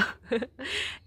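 high, short giggle; real little chuckle; a brief, high laugh. Real, not acted. Laughs before saying it depends.

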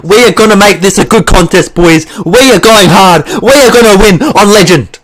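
rally the team like you're winning; getting excited. Real, not acted. Gamer hyping up his team as they come back, excited shout. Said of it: "Don't know why I'm getting so excited".

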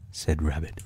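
ordinary delivery

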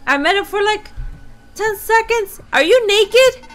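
incredulous. Real, not acted. Shocked at naked killer.

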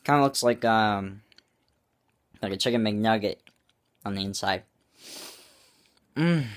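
muffled words between bites; talking while chewing. Real, not acted. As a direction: mouth partly full. Seen 0: bites food earlier; lips pressed, chewing-like through ~6 s.

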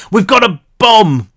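loud, high cracking shout. A narrator's reading. Brick bluffing to armed police, loud cracking shout. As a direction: bluff hard, voice breaking. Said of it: "his voice cracked in a hundred places".

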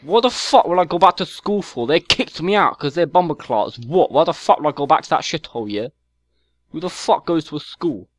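exaggerated London chav accent, mouthy; whiny London chav accent, picking a fight; put-on London chav accent. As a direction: play a stroppy London chav kid on a game mic. Chav troll persona.

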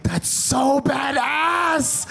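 slow, drawn-out amazement; mock awe. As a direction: nephew impressed by his mom's war record, slow awe. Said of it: "I'm like".

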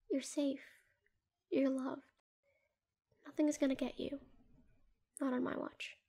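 hushed and tender, lulling someone to sleep; soft murmur to a hurt partner at bedside; soft soothing whisper. Acted.

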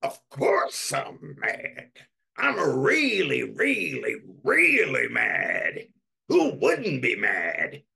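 self-righteous complaining, building on "really"; mock furious phone rant; indignant ranting. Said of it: "telling somebody on the phone".

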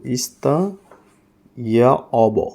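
"ইস্তা ইয়া অব" with ya, pausing after "ইস্তা"; J pronounced ya. Shown as a demonstration. Teacher showing the J sound, "ইস্তা ইয়া অব" with ya. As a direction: break before "ইয়া", saying it as ya. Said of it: "ওনারা ইয়া উচ্চারণ করে".

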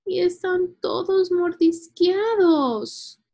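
high, loud, drawn-out whine; aggrieved storybook girl, stretching her words; complaining. A narrator's reading. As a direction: wail the grievance slowly like an upset kid. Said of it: "se quejó Julia".

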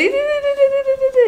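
very high sing-song di di di. Real, not acted. Mother calling her baby's attention, high chirp.

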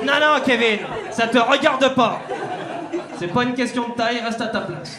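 loud and high, a snooty dog talking back; haughty dog voice. Retold or imitated. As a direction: scold the owner like a proud dog. Dog voiced scolding owner. (chien hautain qui répond fort)

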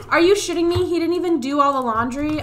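loud, high outraged disbelief; real angry disbelief. Real, not acted. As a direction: explode at the undone laundry. Finds laundry undone.

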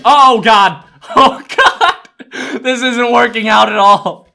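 loud, high, laughing wail; laughing panic. Real, not acted. Player whose plan is not working out, laughing panicked wail. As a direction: wail through helpless laughter.

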